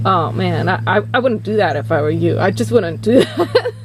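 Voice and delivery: low, rushed imitation of the producer; low-voiced mock reluctance; discouraging male producer. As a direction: drop the voice low and warn them off. Acting the producer's warning.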